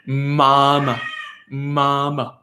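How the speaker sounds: put-on slow, over-mouthed child saying mama; labored slow child. Shown as a demonstration. Therapist imitating a child who learned an exaggerated M sound. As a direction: drag out each mama like a child drilled too hard. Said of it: "it's gonna become like this".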